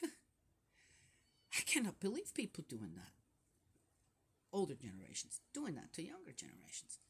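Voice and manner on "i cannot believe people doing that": slow, laughing incredulity; amused and baffled; laughing disbelief. Real, not acted.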